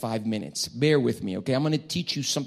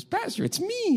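much higher, whiny plea of innocence; mock innocent protest. Counselee to pastor, high mock hurt protest. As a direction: play the wounded good guy.

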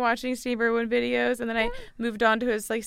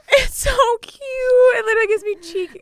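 loud, high, emotional squeal; gushing; 12 semitones higher, 10 dB louder than usual. Real, not acted. Host gushing over a baby video, loud and high. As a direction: overcome with cuteness, almost crying.

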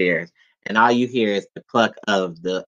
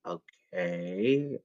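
whisper; 4 semitones lower, 13 dB quieter than usual. Retold or imitated. Awkward moment facing mom.

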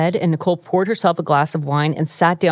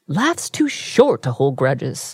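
slow Southern belle drawl; 3 semitones lower, much slower than usual. Acted. Quoting O J to the cohost, slow Southern belle drawl. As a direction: quote it as a languid Southern belle. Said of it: "Why is he a Southern belle".

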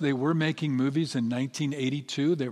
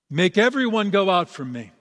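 louder, higher command to clear the room; crying out; 5 semitones higher, 7 dB louder than usual. A narrator's reading. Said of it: "He cried".

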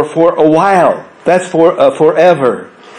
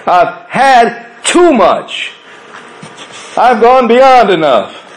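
Elijah's cry; 7 semitones higher, slower than usual. Acted. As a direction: cry out to God, worn out. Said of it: "And you cry out like Elijah did".

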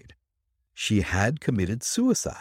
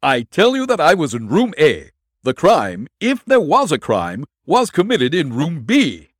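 loud, raised insistence; insistent protest; 7 semitones higher, 7 dB louder than usual. A narrator's reading. Prisoner insisting to detective, loud protest. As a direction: hammer each claim home.

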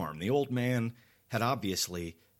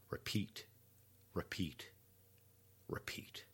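each repeat softer until whispered; fading to a whisper; 12 dB quieter than usual. A narrator's reading. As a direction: let it fade away to a whisper.

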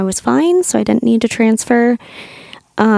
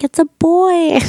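put-on higher, a kid's excited announcement; voicing her little son; 9 semitones higher, slower than usual. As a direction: mom playing her seven-year-old announcing the baby. Mother voices her young son.

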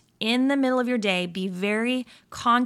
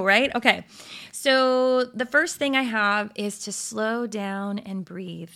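ordinary talk, nothing marked

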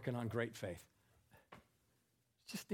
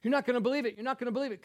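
higher, excited retold phone call; mock hysterical; 10 semitones higher, 9 dB louder, much faster than usual. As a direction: rush it out, all worked up.

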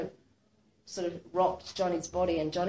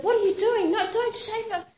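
high, loud, panicked protest; alarmed shout; 12 semitones higher, 5 dB louder than usual. Retold or imitated. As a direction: shout to stop the nurse. Nurse shaving off her hair.

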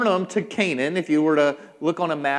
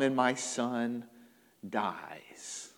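slow, sinking to a low whisper; hushed, low, somber ending; grave dread; 6 semitones lower, 8 dB quieter, much slower than usual. Acted. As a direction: let the voice fall away, pausing before the last word. Father imagines his son dying.

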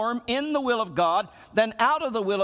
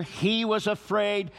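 ordinary delivery

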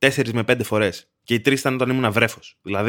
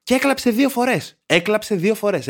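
high-pitched, incredulous complaint; exasperated disbelief; 7 semitones higher than usual. Real, not acted. Host recounting a friend crying twice at his place, exasperated disbelief. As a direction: stress the outrage of it, pitched up high.

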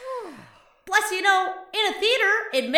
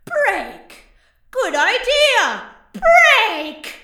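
building louder and higher, slow repetitions of break; dazed agreement; 7 semitones higher, 9 dB louder, much slower than usual. Retold or imitated. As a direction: work up to a manic shout of break. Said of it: "kinda nods and says".

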